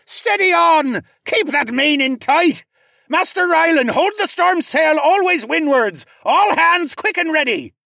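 high, loud captain's commands; ship's captain shouting to all hands; crying out orders. A narrator's reading. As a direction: bellow orders over a screeching storm. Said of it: "Nemus cried out over the screech".

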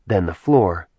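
usual speaking voice

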